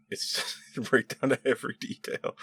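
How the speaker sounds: high, amused words through a laugh. Real, not acted. Telling viewers about the Star Trek copy, laughing. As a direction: laugh while marvelling at it. Cracking up at the copied plot.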